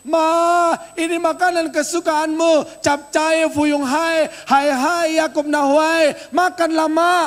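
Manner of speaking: hammed-up shout offering her favourite food; mock calling to the dead. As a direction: mourner calling out loudly to the dead mother with food. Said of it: "Terus kita bilang begini".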